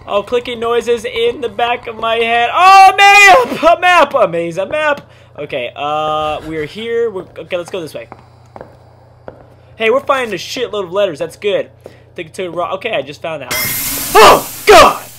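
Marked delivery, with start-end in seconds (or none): 2.5-5.1 high, loud, thrilled cry over the map; giddy high-pitched burst of joy; excited yelp. Real, not acted. Finds a map in game. 14.2-15.1 high, loud frightened cry; real shock, loud and high; startled scream. Real, not acted. Sudden jumpscare.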